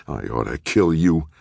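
understated menacing snarl. A narrator's reading. Jordan turns on the man on the floor, snarling. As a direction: snarl it as a threat. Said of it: "Jordan snarled".

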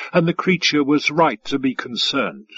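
ordinary delivery, nothing marked

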